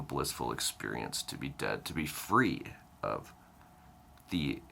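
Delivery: ordinary delivery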